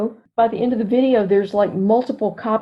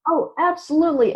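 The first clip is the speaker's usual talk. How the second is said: ordinary delivery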